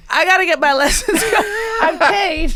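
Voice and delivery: laugh-cry the line at yourself. Real, not acted. Owning up to unused French lessons, laughing. Laughing at her own situation.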